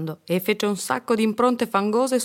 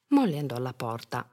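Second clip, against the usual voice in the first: usual speaking voice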